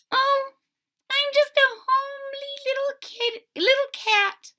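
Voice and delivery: very high, small kitten voice; tiny kitten voice. Acted. Kitten explains to the old couple, very high and small. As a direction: squeak it out like a shy little cat. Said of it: "said the kitten".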